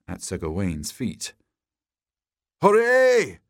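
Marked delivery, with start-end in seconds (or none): 2.6-3.4 high, loud victory cheer; triumphant shout. A narrator's reading. Knight cheering his win over the dragon, triumphant shout. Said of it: "shouted Sir Gawain triumphantly".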